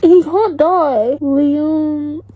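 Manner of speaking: high, distraught denial; upset wail at a favourite's death; near tears. Real, not acted. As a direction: refuse to accept it, on the verge of crying. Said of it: "I'm gonna cry".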